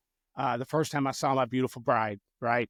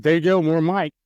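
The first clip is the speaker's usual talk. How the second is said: raised voice, repeating to be heard; louder repeat; 3 semitones higher, 8 dB louder than usual. Real, not acted. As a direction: say it louder for someone who missed it. What's that?.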